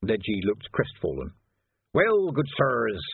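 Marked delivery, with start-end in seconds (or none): 1.9-3.0 slow, louder theatrical start; comic gravedigger again. A narrator's reading. Ledgy slipping back into comic gravedigger voice. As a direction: try the stage act once more. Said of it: "comic gravedigger stuff".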